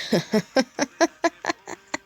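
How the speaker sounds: high villain cackle, loud and far off; evil cackle. Acted. Green Goblin gloats over Spider-Man with an evil cackle.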